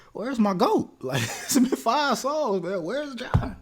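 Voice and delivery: high, loud comic complaint; mock exasperated wail. Fan demanding [name] after five songs, mock distraught. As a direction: wail for your missing hero. Cries out for his favorite Beatle.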